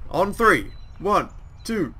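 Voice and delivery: high, loud, drawn-out sarcastic cheer; team shout. Acted. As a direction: yell the team name ironically.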